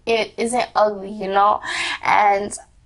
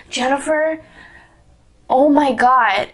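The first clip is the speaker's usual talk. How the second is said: exasperated mom; 4 semitones higher, 4 dB louder than usual. Retold or imitated. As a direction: drag out the mother's dismay.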